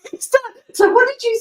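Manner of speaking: laughing high and bright through the words. Real, not acted. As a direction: giggle at the joking husband. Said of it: "laughing at husband".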